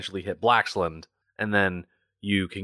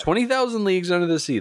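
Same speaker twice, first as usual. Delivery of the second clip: high-pitched emphasis; 11 semitones higher, much faster than usual. Real, not acted. Repeating the title emphatically.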